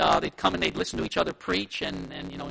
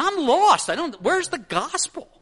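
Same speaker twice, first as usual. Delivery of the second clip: higher, exasperated mock confusion; mock bewilderment; 9 semitones higher, 6 dB louder, slower than usual. Listener lost among Hebrew terms, mock bewilderment. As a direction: act hopelessly lost, pitch swooping up. Acting out baffled listener's reaction.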